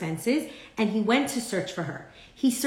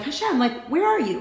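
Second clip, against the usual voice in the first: pleading cry; 6 semitones higher than usual. Retold or imitated. Pleading to God in hardship.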